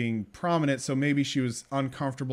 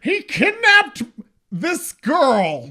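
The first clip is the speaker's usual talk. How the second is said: very high, loud, drawn-out movie gasp of outrage; mock outrage; 11 semitones higher, 9 dB louder, much slower than usual. Co-host parodying the hero reporting a kidnapping, mock-dramatic. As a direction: stretch it out in hammed-up alarm. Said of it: "But in this one, it's like".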